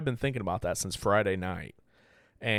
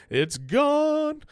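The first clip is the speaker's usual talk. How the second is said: "It's gone" held up even higher; excited repeat; 4 dB louder than usual. Real, not acted. To listeners, stressing there's no undo, in a high stretched call.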